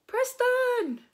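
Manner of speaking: high, loud, drawn-out name call; shout. Acted. Max calling to Preston as he leaves, long high shout. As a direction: holler the name into the distance. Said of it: "shouted Max".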